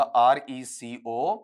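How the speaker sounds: slow spelling-out with gaps between letters; spaced, deliberate spelling. Shown as a demonstration. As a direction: spell it out slowly as if writing each letter. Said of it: "अलग-अलग अक्षरों में ऐसे लिखूंगा".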